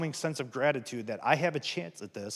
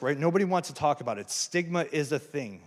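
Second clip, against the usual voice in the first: normal talking voice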